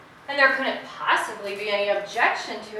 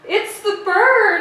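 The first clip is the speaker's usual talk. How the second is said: shocked gasp; 9 semitones higher, 12 dB louder, slower than usual. Acted. Shocked discovery of the dead bird.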